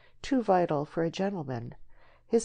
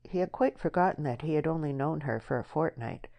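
ordinary delivery

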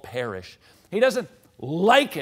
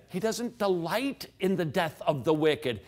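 ordinary talk

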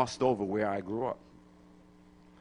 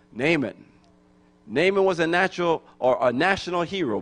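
normal talking voice

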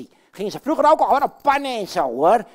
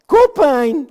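crying out; 4 semitones higher, 9 dB louder than usual. Acted. Katrijn cries headache.

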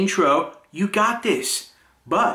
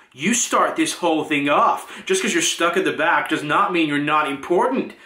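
ordinary delivery

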